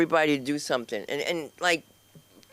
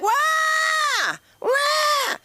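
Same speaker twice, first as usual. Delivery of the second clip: crying kids imitation. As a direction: wail like a bawling toddler. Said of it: "The kids were like".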